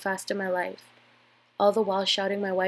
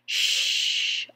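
long voiceless shush; 8 semitones lower, 4 dB louder than usual. A narrator's reading. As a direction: urgently hush someone. Hushing the man who shouted.

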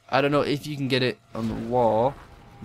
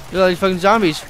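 loud alarmed; 5 semitones higher, 6 dB louder, faster than usual. Real, not acted. Repeated louder in alarm.

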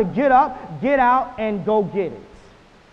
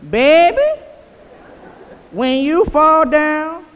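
slow, louder grandma's call; 6 semitones higher, 7 dB louder, much slower than usual. Retold or imitated. Speaker quotes his grandmother to the audience, slow. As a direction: stretch it out like grandma. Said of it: "she would say".

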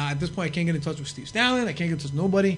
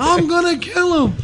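high, loud, slow enraged shout; furious shout; 12 semitones higher, 6 dB louder, slower than usual. Retold or imitated. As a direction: bellow the order in fury. Sandman slamming car door.

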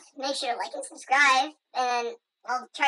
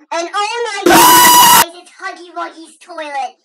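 excited shout; 3 semitones higher, 14 dB louder than usual. Pretend. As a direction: shriek with joy at the find. Excited at toilet.

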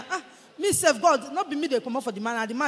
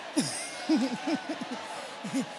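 quick laughing burst; 10 dB quieter than usual. Real, not acted. Laugh after husband quote.

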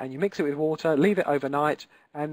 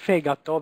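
"fegato" stressed on FE, FE-ga-to, the rest light; stress on first syllable; 4 dB louder than usual. Shown as a demonstration. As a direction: hit the FE of "fegato", let ga-to fall away. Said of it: "the accent here is on the first syllable".

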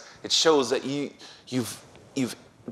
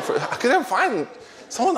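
laughing excuse; 12 semitones higher than usual. Retold or imitated. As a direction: squeak out the excuse while laughing. Speaker retells own excuse laughing.

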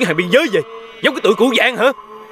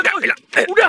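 agitated demand; 9 semitones higher than usual. Acted. Struggling against someone restraining them.

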